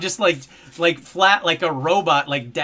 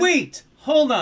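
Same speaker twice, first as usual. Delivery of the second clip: alarmed; 8 semitones higher, slower than usual. Real, not acted. Reacting to the sad topic.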